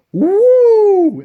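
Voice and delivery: high, loud signature woo; Flair woo. Acted. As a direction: whoop it out like the Nature Boy. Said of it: "the Nature Boy".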